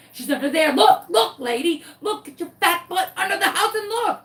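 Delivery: high, loud bossy dog laughing at her owner; sassy dog voice. Retold or imitated. Dog ordering her owner to look under the house, bossy. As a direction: boss the lady around, cheeky. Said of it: "And Sunshine was like".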